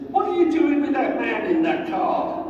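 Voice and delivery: put-on high, indignant old lady voice; a shrill old lady demanding answers; feisty old lady. Old lady walking her dog, challenging the guards, high indignant voice.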